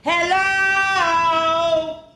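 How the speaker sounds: loud sing-song long hello; booming sung hello; really loud. Shown as a demonstration. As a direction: call it really nice and loud. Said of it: "really nice and loud".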